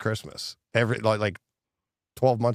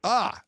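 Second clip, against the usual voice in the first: mock scream; 14 semitones higher than usual. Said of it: "I would have been like".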